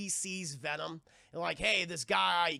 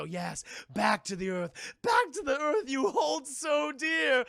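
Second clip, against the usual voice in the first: slow, higher, louder hammed chant; ecstatic Ivy; 7 semitones higher, 5 dB louder, slower than usual. Ivy rising from the plants, slow hammed rapture. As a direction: declaim it rapturously. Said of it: "And she starts to go".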